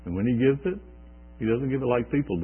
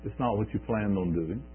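normal talking voice

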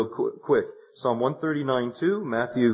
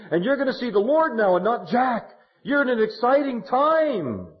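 fervent preaching; 8 semitones higher than usual. Acted. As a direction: preach it to her with rising fire. Preaches to the widow.

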